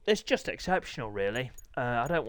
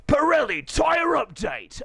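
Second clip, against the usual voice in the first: high, loud, slow announcer shout; big announcement; 12 semitones higher, 6 dB louder, slower than usual. Pretend. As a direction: belt out the headline, stretched and high. Announces tyre update like a jingle.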